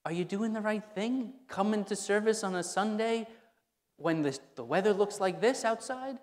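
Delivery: a hint of nagging critics; doubting questioner. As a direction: needle him with a mock doubting question. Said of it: "plagued by people asking him".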